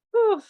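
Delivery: high, loud puff of relief; relieved whew. Real, not acted. Speaker after confessing she is learning humility, relieved whew. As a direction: blow out a big whew.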